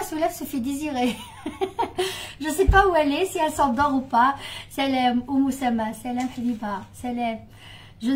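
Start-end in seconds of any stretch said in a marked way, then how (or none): 1.2-2.4 a quick airy giggle, higher up; high breathless giggle. Real, not acted. Laughing to her viewers about her absent sister. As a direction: giggle under your breath at the sister's lateness.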